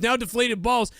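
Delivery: ordinary talk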